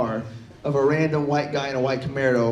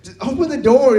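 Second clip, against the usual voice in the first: raised, higher urgent voice; urgent demand; 7 semitones higher, 5 dB louder than usual. Acted. Locked-out kid to his brother at the door, urgent demand. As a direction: press him to open up, voice lifted.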